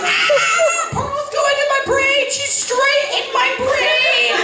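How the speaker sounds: mock panicked child crying out; panicked kid. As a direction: wail it like a scared kid. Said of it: "And I was like".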